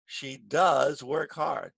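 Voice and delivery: long, weighty "does"; slow down and lean on "does"; emphatic, drawn-out "does"; stress on DOES. Shown as a demonstration.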